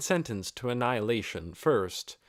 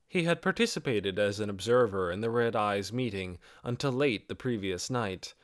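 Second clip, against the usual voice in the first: usual speaking voice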